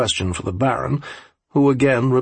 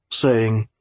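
plain reading voice

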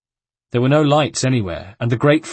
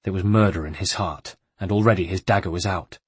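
ordinary delivery

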